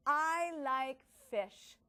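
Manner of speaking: slow, stretched-out reading of each word; stretched slowly. Shown as a demonstration. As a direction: stretch every word like for kindergartners. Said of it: "Let's stretch it together".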